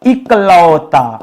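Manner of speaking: इकलौता stretched slowly, clear short इ at the start. Shown as a demonstration. Teacher drawing out इकलौता for the class. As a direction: spell it out slowly, इ-कलौता. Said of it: "ई रहेगा ना".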